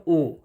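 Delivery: ओ as a single long vowel; pronunciation demo. Shown as a demonstration. Teacher showing the English pronunciation, a lone vowel ओ. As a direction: sound out ओ alone with no r. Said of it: "इसको English में उच्चारण करते हैं".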